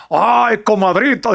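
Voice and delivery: high, hammed-up norteño radio DJ; norteña radio announcer. Host imitating a Sonora radio announcer for laughs, hammed-up DJ voice. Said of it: "un locutor de radio que era de música norteña y decía".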